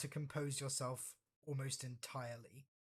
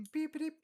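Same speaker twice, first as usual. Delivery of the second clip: playful mock beep noise; imitated console beep; 14 semitones higher, 5 dB louder, faster than usual. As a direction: chirp it like a little console alert. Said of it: "you hear a".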